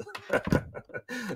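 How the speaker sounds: breathy silent giggle at his own joke; wheezy laugh at his own pun; corny dad laugh. Real, not acted. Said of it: "I'm laughing at my own jokes".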